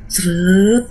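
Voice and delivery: high, drawn-out "sreeet"; imitated dragging sound. Telling a friend about the sarong pulled upward, imitated dragging sound. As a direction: stretch out a high swoosh of being pulled.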